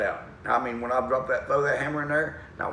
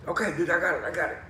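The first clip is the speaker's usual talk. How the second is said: higher buddy voice, brushing off advice; reply as the friend, pitched up; Dana; 7 semitones higher, faster than usual. Retold or imitated. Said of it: "He said".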